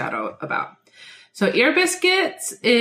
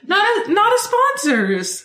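showy announcer; 12 semitones higher, slower than usual. Pretend. Announcing the segment, high and slow.

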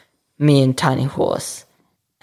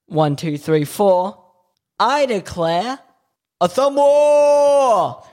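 thumb war call; 7 semitones higher, 4 dB louder, slower than usual. Retold or imitated. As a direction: build to a big drawn-out shout. Acted thumb war declaration call.

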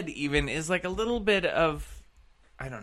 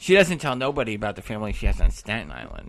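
mock New York accent; 6 semitones lower, 5 dB louder, faster than usual.

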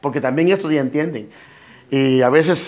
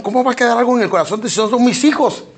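mock incredulous objection; 8 semitones higher than usual. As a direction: scoff at the idea, raised pitch. Oyente escéptico objeta. (objeción incrédula, más aguda y fuerte)

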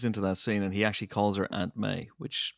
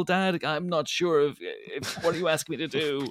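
put-on raised, whiny kid; high whiny son voice; 7 semitones higher than usual. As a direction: play a baffled son, pitched up. Playing the confused son.

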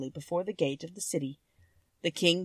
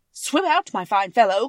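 mock teasing call; 5 semitones higher, 10 dB louder than usual. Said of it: "cried my grandfather".

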